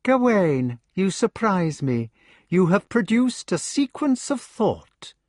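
high, icy, detached sneer; cold. A narrator's reading. Mordred taunts his raging brother, coldly. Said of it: "speaking coldly".

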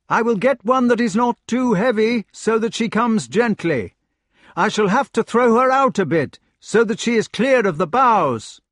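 high, loud call from a treetop; shouting down from the tree. Acted. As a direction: call down to the lady below. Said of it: "He shouted to the lady".

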